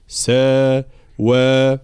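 "ساوى" slowly, both vowels equally long; equal madds. Shown as a demonstration. Teacher correcting the lengths, "ساوى" with equal madds. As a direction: stretch "سا" and "وى" of "ساوى" alike. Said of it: "كلها مدود متساوية".

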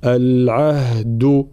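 العهد slowly, h then a firm voiced d; effortful clear dal. Shown as a demonstration. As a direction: work to land a true d in العهد. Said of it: "يلزمنا شوية مجهود هنا لنطق الدال". (العهد بدال واضحة بعد الهاء)

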